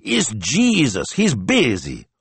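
high-pitched exasperated signora; high, indignant rebuke; scolding. A narrator's reading. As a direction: tell her off emphatically. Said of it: "Signor Barbaro scolded".

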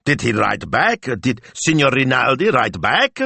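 anxious, high-pitched questioning; urging. A narrator's reading. Shop owner presses his assistant for news, urging. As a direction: press desperately for the answer. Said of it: "urged, his head threatening to burst".

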